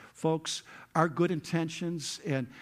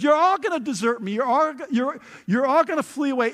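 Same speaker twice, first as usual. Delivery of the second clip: urgent Jesus; 9 semitones higher, 7 dB louder than usual. Retold or imitated. Jesus voiced foretelling desertion.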